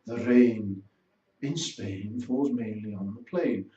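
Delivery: slow, careful Received Pronunciation; RP accent. Shown as a demonstration. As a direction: recite it like an elocution exercise in RP. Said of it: "you would begin speaking rather like them".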